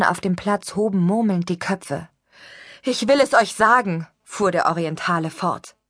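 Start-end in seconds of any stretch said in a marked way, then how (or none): 2.8-4.1 a stranger prophesying loud and high to the crowd; high preaching voice. Acted. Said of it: "eine hohe Stimme".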